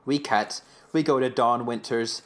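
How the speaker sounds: ordinary talk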